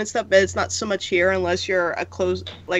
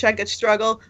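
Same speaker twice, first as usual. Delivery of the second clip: ordinary talk, nothing marked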